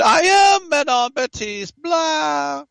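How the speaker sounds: loud, high French heroine voice, played up big; proudly belting out her game character's line. Host retells the actress voicing her French character, loud and high. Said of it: "And she said".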